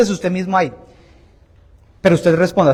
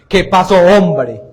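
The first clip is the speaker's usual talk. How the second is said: slow, louder imitation of a priest; imitating the priest; 5 dB louder, slower than usual. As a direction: draw it out loudly, mimicking the priest. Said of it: "Él dice así".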